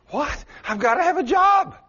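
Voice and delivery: high, loud desperate shout; panicked outcry. Acted. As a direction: cry out in panic at losing the job. Fired worker's panicked outcry.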